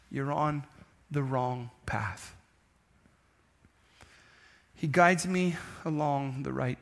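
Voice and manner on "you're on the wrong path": low, slow, soft warning; solemn hush. Real, not acted. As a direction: let the words sink in quietly.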